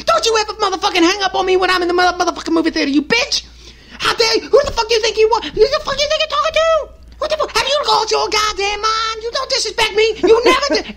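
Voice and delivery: high, fast, put-on furious rant; mock screaming at her. Moviegoer screaming at his girlfriend who hung up, high hammed-up rant. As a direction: ham up a guy yelling down the phone at his girlfriend. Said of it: "starts screaming at her".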